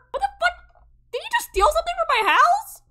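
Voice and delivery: high, fast outraged squeal; shocked shriek. Real, not acted. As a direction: squeal in disbelief. Robber in the house.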